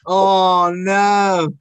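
loud, high, drawn-out groan of dread; a long, high "oh no" at being put on the spot; playful dread. Real, not acted. Guest put on the spot about accents, playful dread.

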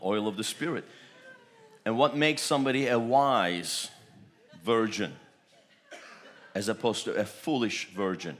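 usual speaking voice

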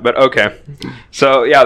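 normal talking voice

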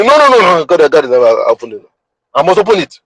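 hammed-up stubborn husband refusing help; loud mock insistence; mock macho refusal.

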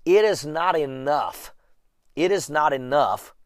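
ordinary talk, nothing marked

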